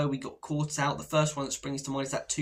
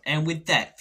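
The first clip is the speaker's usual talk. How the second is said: mock dramatic voiceover, louder; reel voiceover again; 3 semitones higher, 6 dB louder than usual. For the next reel in the feed, a hammed-up voiceover. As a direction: announce it like a trailer narrator. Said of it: "he flicked to the next one".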